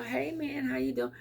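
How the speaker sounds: bright, breezy hello as if nothing happened; cheery greeting. Pretend. Calling an estranged friend, breezy and cheerful. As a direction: greet her like no time passed.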